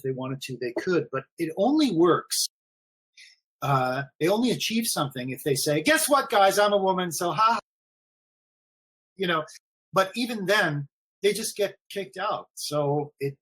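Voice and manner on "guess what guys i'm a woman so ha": higher-pitched, gleeful taunting reveal; imitating a triumphant "so there"; mock gloating. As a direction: crow it like a mocking gotcha. Mimics woman gleefully revealing herself.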